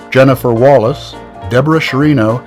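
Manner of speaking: ordinary talk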